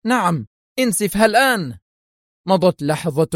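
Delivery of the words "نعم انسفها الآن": high, firm confirmation; stern. A narrator's reading. Boss confirms demolition order to henchman, stern. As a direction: confirm the order, brooking no doubt. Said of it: "أجابته في صرامة".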